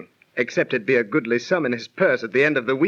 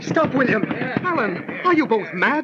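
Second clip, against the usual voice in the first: stepping in; 7 semitones higher than usual. Acted. Edward breaks up the quarrel.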